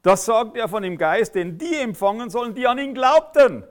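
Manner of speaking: preacher thunders the verse; emphatic reading. Shown as a demonstration. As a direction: proclaim the verse against the objection. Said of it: "hier steht nämlich ganz was anderes".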